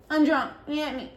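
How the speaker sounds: put-on high, whiny baby voice; pouty high-pitched whine; baby talk. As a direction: imitate a drunk friend sounding like a toddler. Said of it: "the fucking babysitting".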